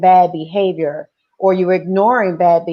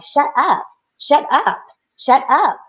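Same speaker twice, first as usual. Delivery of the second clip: higher, sing-song "shut up" taunt; mock bossy girl; 5 semitones higher than usual. The girl in daughter's face taunting her, mild mock. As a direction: imitate a pushy schoolgirl, lightly. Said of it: "she was like".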